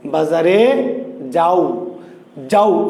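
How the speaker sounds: যাও glided as one syllable; vowels run together. Shown as a demonstration. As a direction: join আ and ও in one breath in "যাও". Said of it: "একসাথে".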